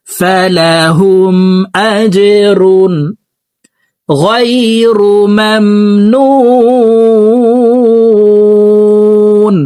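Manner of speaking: আজরুন with a bounced jim, slow chant. Shown as a demonstration. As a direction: drag মামনুন long. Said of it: "ধাক্কা দিয়ে উচ্চারণ করতে হবে". (জিম ধাক্কা দিয়ে)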